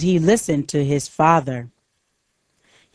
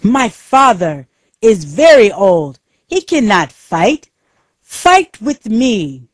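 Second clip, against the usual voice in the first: raised, louder storybook shout; angry shout; 5 semitones higher, 5 dB louder than usual. A narrator's reading. Young prince challenging Takashi to spare his old father, angry shout. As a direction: call it out in mild, indignant anger. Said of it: "He shouted at Takashi".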